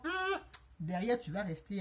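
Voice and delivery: ordinary talk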